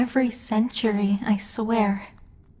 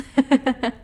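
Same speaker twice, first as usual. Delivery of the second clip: gloating chuckle; 3 semitones higher than usual. Acted. Demon lord gloats over punishing workers.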